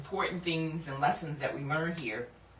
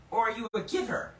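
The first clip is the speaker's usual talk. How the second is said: ordinary talk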